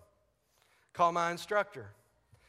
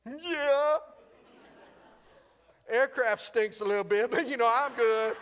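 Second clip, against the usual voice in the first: high, loud, strained-sounding reassurance; comic shaken pilot; 8 semitones higher, 7 dB louder than usual. Acted. Shaken pilot telling airport owner he's okay, high and loud. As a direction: insist you're fine in a raised voice.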